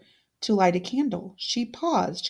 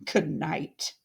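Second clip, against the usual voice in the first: cold farewell; 5 semitones lower, 4 dB quieter than usual. Acted. Odette leaving coldly.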